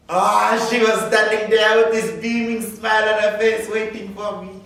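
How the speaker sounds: light up at seeing her; delighted. Acted. Delighted first sight of adoptive mother.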